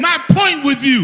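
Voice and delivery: shouted high, leading the crowd; loud call-and-response. Shown as a demonstration. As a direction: call it out big for them to echo. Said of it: "repeat after me and make it loud".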